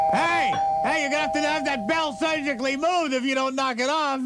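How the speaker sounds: irritated shout to the pizza guy; yelling at bell. Retold or imitated. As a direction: holler at whoever keeps ringing. Yelling at the doorbell.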